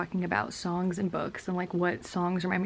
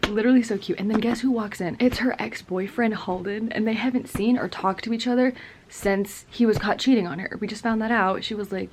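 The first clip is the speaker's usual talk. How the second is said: ordinary talk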